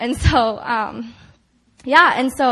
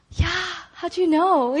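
higher, slow, mock baffled reply; imitated bewildered answer, pitched up; retold confusion; 6 semitones higher, slower than usual. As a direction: draw it out as if puzzled. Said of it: "I was so confused".

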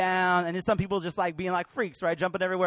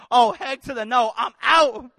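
louder, hammed-up bail-out line; mock refusal; 13 dB louder than usual. Moses imagined refusing the burning bush, loud mock exit. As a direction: blurt it like you're fleeing. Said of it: "If Moses would have said".

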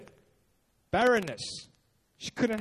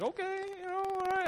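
high, soft, caving-in husband voice; resigned husband; 10 semitones higher, 5 dB quieter than usual. Retold or imitated. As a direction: give in like a henpecked husband. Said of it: "like that husband who says".

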